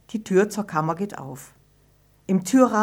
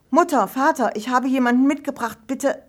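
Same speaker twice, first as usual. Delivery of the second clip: high, louder, nervous plea to her parents; anxious plea; 6 semitones higher, 5 dB louder than usual. A narrator's reading. Ida to her strict parents, an anxious high plea. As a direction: ask timidly, afraid of a scolding. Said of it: "Sie fürchtet".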